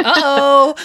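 high, loud uh-oh; mock alarm. Imitating friends watching her read, mock alarm. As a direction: imitate friends sounding a playful warning.

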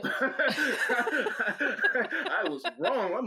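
high laugh through the word. Real, not acted. Laughing at own story.

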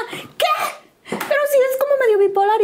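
high, fast mock outrage; shocked mom. Mother hearing of the engagement by phone, high shock. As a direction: exclaim it high and quick, aghast. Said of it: "Y mi mamá".

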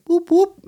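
high whooping siren sound; police siren imitation. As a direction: mimic a police car's whoop. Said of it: "I hear the police, like".

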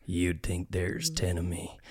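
low, slow, soft menacing growl; half growl. Retold or imitated. Fighter to a rival fan, half-growled threat. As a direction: growl it slowly under your breath, country accent. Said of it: "half growling, half grimacing".

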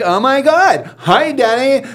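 high, squealy imitation of delight; mock gushing greeting. Husband mimicking his wife greeting a coworker, mock gushing. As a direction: exaggerate the thrilled hello, way up high. Acting wife's gushy work story.

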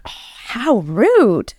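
ordinary delivery

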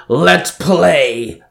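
drawn-out villain roar. A narrator's reading. Villain summons his guitar to fight, roaring. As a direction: stretch it into a challenge. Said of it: "Banjan roared".

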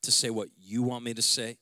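soft, low, prayerful voice leading the church; hushed prayer. Acted. Pastor praying softly over his church before the sermon. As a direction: pray quietly over the congregation.

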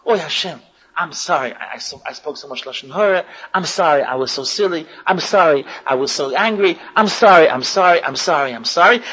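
low, soft repeated apologies; contrite plea to God. Retold or imitated. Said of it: "you start talking to Hashem".

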